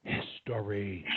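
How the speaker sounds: slow "History", "His" whispered, rest voiced; whispered first syllable. Shown as a demonstration. Coach repeats for boy, "History" starting in whisper. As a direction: breathe "His" without voice, then voice "tory" in "History". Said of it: "I'm gonna whisper the first syllable".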